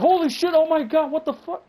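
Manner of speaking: high-pitched mock freak-out; exaggerated alarmed outburst; acted shocked reaction. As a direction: act horrified, voice shooting way up. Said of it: "my reaction would have been like".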